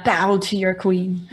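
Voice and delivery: slow, haughty royal command; imperious queen. Retold or imitated. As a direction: demand a bow like a queen. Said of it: "Kinda reminds me of Jaina".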